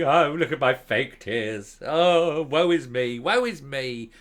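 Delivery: mocking the politician's fake tears, hammed-up slow woe; mock fake crying. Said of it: "isn't she, at pretending".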